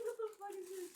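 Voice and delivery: high-pitched, giggly mock confusion; amused bafflement. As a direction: retell your puzzlement in a high squeak. Laughs at own puzzled reaction.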